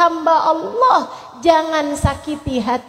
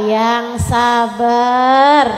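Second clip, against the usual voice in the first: "yang sabar" stretched out very slowly; lingering, patient advice; drawn out; 3 semitones lower, much slower than usual. Acted. Preacher drawing out advice. ("yang sabar" diucapkan sangat pelan dan panjang)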